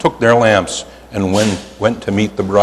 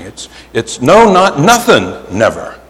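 slow, each negative hit; playful slow emphasis; piled-up negatives. Shown as a demonstration. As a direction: stack the no's with a laugh. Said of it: "the quadruple negative".

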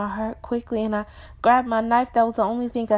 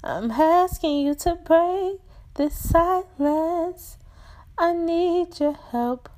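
crying out; 5 semitones higher than usual. Acted. Hurt visitor begging for help, pleading cry. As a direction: plead wearily, voice raised in pitch. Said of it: "She cried".

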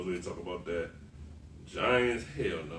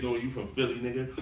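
normal talking voice

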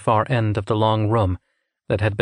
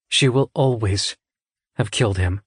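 usual speaking voice

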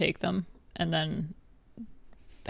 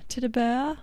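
high drawled catchphrase, rising at the end; child character voice; 6 semitones higher than usual. Acted. Co-host demonstrating the child who owns the bear, high voice. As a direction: pipe it up like a small child. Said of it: "not quite as high as the, as a child".

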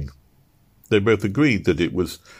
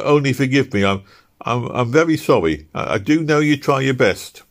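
halting, stammering sorry, a bit louder; awkward apology; 7 semitones higher, 4 dB louder than usual. Acted. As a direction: fumble an apology to his crying wife. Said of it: "said Easton awkwardly".

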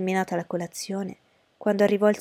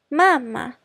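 put-on high, loud girlish call; exclaiming; 6 semitones higher, 6 dB louder than usual. A narrator's reading. Daughter cutting in on her mother, exclaiming. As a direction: cry out to get attention. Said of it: "esclamò Lydia".

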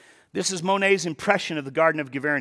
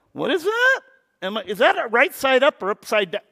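high, loud puzzled outburst; mock bafflement; 6 semitones higher, 4 dB louder than usual. Churchgoer puzzling over an abstract painting, high mock confusion. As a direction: play the baffled viewer of abstract art.

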